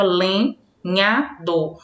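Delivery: "lenhador" very slowly, broken into len-nha-dor; syllable by syllable. Shown as a demonstration. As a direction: demonstrate "lenhador" with a pause after each syllable. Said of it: "pronunciados numa só emissão de voz".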